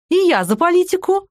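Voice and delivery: high, loud gleeful echo. A narrator's reading. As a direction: pipe up with glee. Sergey delighted echo.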